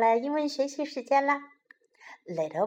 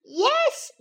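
put-on very high, gleeful child voice; tickled small bunny; giggly little bunny; 12 semitones higher than usual. A narrator's reading. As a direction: pipe up mischievously, as if about to giggle. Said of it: "giggled little bunny".

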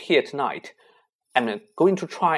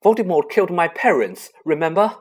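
higher and louder, urgent and fierce; impassioned outburst; 7 semitones higher, 9 dB louder than usual. A narrator's reading. Harry insisting to his friends he must go: fierce outburst. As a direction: pour out desperate anger.

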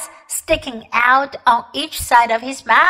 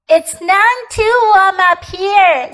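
put-on girl voice; 8 semitones higher, 4 dB louder than usual. Polly voiced in read story.

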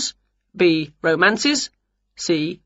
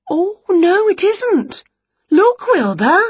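high loud startled witch voice; surprised witch; 13 semitones higher, 4 dB louder, slower than usual. Acted. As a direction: gasp it out to the cat. Winnie surprised.

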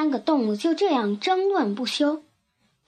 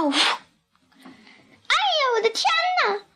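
put-on high-pitched startled old woman; acted squeal of alarm from a little old woman; frightened old woman's cry; 13 semitones higher, much faster than usual. A narrator's reading. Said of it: "瘦小的老妇人喊起来".

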